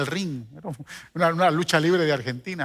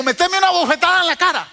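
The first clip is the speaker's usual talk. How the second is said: "meteme una bofetada en la cara" pitched much higher and louder; insistent plea; 12 semitones higher, 6 dB louder, faster than usual. Retold or imitated. Boy insists again that the girl slap him, high and loud.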